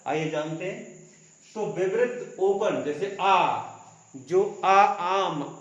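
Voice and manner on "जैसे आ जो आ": आ called out loud with the mouth wide open; a loud, wide-open आ vowel demo. Shown as a demonstration. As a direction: open the jaw fully and sound आ strong and loud. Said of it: "तो पूरा मुंह खोलकर".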